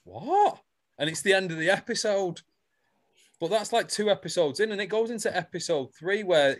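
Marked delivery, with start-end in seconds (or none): 0.0-0.6 high, rising mock-shocked question; exaggerated collective gasp of a word; shocked disbelief. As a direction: swoop right up in comic disbelief. Acts out everyone's shocked reply.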